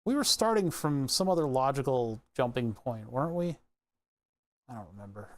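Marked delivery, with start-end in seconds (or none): 4.7-5.3 barely audible, under the breath; muttered aside. Real, not acted. Wiring a circuit, admitting he forgot, muttered under breath. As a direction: mumble it to yourself, almost swallowed.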